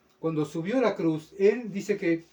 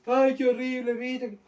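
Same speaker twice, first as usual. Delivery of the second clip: high-pitched mock whining; llorón burlesco; 7 semitones higher, slower than usual. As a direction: imitate a crybaby complaining, pitched way up. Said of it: "llorando ahí, ¿verdad?". (quejido llorón fingido)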